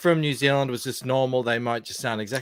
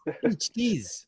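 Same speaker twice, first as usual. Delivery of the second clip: higher New Zealand vowels, 'is' for 'as'; higher mock New Zealand accent; 8 semitones higher, 5 dB quieter than usual. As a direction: say 'beached is' in a Kiwi accent. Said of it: "But they say".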